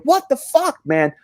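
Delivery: high, loud outraged complaint. Retold or imitated. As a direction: blast your friend for the overdose, high and loud. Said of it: "I said".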